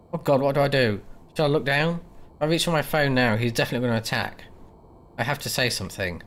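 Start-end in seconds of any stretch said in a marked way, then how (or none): none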